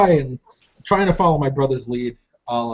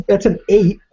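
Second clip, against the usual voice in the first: excited at roll; 8 semitones higher than usual. Real, not acted. Player reacts to die roll.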